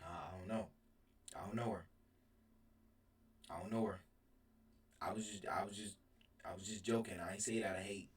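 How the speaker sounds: low, quiet excuses; sheepish. Retold or imitated. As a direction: mumble an apology. Excusing himself to his mom.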